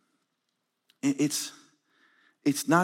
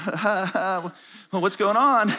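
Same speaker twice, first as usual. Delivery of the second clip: bewildered laughing; 5 semitones higher than usual. Retold or imitated. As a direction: laugh along while totally lost. Acts himself lost on camera, laughing.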